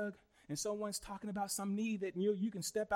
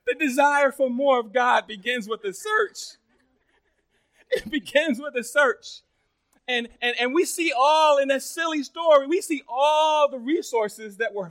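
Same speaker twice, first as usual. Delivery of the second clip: loud, raised, emphatic sermon; preaching at full force; 5 semitones higher, 12 dB louder than usual. Real, not acted. Preacher voicing God's demand for all of you, preaching forcefully. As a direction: build to a booming climax.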